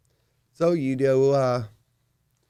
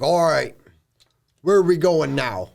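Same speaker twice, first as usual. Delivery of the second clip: higher, louder orc chief voice; 4 semitones higher, 6 dB louder than usual. Acted. Orc chief asking the party the way, raised voice. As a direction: play a pushy orc chief.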